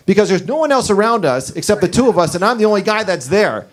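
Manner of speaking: ordinary talk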